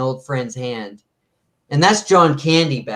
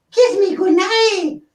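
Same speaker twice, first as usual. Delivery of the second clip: very high, slow, pleading mouse squeak; high puppet squeak, slow and wheedling; Topo Gigio voice; slower than usual. Retold or imitated. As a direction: beg sweetly for a goodnight kiss. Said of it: "I can't do Topo Gigio".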